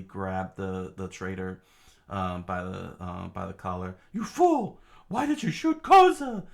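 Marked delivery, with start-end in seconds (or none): 4.1-6.5 very high, loud falsetto outrage; outraged yell. Acted. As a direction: berate the traitor at full volume. Soldier berating the shooter.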